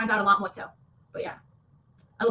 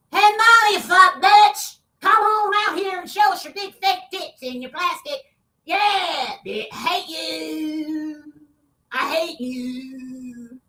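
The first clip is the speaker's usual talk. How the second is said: mocking imitation of Savage; 2 semitones higher, 3 dB louder, much slower than usual. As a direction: taunt her like a heckler outside the house. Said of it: "If Savage went by".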